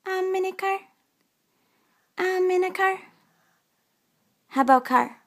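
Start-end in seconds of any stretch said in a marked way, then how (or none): 0.1-0.8 high, soft pretend voice; high play voice. Mother voicing pretend play about riding in a car. 2.2-3.0 high, soft pretend voice; high play voice. Mother voicing pretend play about riding in a car.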